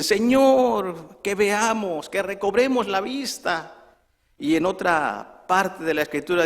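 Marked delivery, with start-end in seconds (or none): 0.0-4.0 high, raised cry, strongest on the opening word; acted blind men's appeal; imploring. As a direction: call out a plea to the Lord. Acted blind men implore Jesus.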